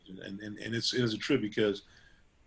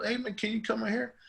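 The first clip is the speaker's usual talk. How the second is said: a relative on the phone, pitched well up; a high, friendly ask for a favor; voiced relative; 11 semitones higher than usual. Relative calling to ask for a haircut, high voiced. Relative asking him over.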